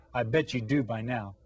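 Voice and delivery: ordinary delivery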